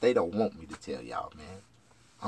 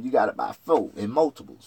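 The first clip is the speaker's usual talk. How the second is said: ordinary talk, nothing marked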